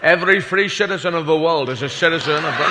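slurred 'sh' for s in a speech; film-star impression with shushed s sounds; Sean Connery doing President Kennedy. Film star playing president addresses Berlin, slushy-s oratory. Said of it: "Sean Connery doing, uh, President Kennedy".

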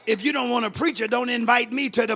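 loud, high, mounting sermon cry; full-force preaching. Real, not acted. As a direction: build into an impassioned shouted testimony.

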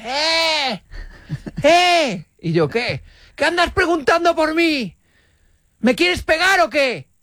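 high, tense mock yelling at him; angry shout. Dog owner shouting at the narrator in the park, high and angry. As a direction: bark it like a riled dog owner.

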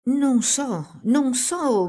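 high, stammered and scared; bambino impaurito che balbetta; frightened stammer. A narrator's reading. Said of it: "balbettò il bambino impaurito".

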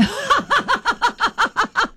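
high staccato laugh; a high, pulsing laugh acted out as a clue; demonstrated laugh. Shown as a demonstration. Acting out the clue.

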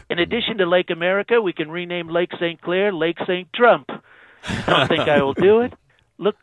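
channel a bragging president on the phone; Trump impression. President phoning into radio show, Trump-style boast.

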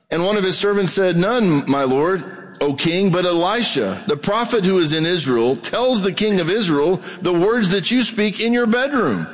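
preacher reading Second Kings aloud; scripture reading. Acted. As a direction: read the passage out to the congregation.

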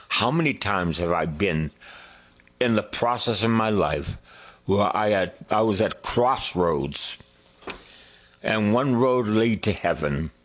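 normal talking voice

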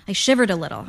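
ordinary delivery, nothing marked

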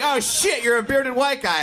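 high, startled realization; surprised booker. Retold or imitated. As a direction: jump up in pitch, shocked.

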